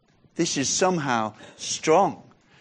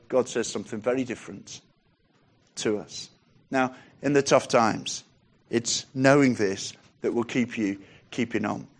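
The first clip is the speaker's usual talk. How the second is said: normal talking voice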